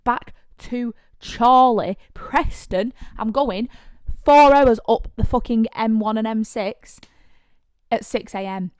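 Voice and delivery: turning down the man outside the club, loud and exasperated; exasperated rant. Retold or imitated. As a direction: spell it out to him, fed up and loud. Refusing a pushy man, exasperated.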